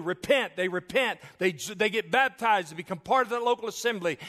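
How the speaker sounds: loud, high-pitched pulpit preaching, pressing each point home; preaching at full force. Acted. Pastor proclaiming to the congregation, loud fervent preaching. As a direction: drive the gospel home from the pulpit.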